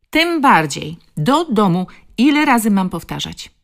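loud, high, impatient grandmother; exasperated scolding. Acted. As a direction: order the child home, out of patience. Grandmother repeats her order angrily. (głośne, zniecierpliwione strofowanie)

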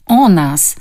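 loud, sudden outburst; short, forceful exclamation; almost shouting. A narrator's reading. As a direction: nearly shout it. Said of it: "krzyknął niemal".

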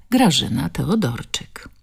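usual speaking voice

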